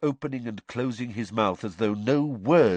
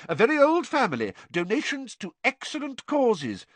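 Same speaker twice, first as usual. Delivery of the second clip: affronted Fudge; 14 semitones higher than usual. A narrator's reading. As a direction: bristle at the accusation. Fudge continuing.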